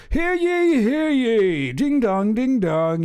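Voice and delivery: high, bellowing mock herald call; town crier. Mocking the palace as a town crier, hammed-up herald call. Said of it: "like the town crier".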